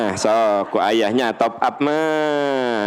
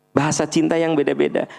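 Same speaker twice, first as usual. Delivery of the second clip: ordinary talk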